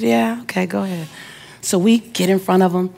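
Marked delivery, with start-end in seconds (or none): 0.0-1.1 imitating an offhand driver, softly; quiet, unbothered mumble of assent, lightly acted; nonchalant. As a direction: wave someone through casually. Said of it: "he just kinda nonchalantly waves me".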